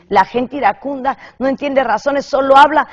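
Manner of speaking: rising emphasis. Real, not acted. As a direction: build it up and punch the end.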